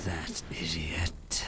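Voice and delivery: soft, slow, whispered hiss; hissed under breath. A narrator's reading. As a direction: mutter it in fury. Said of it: "Kecletta hissed under her breath".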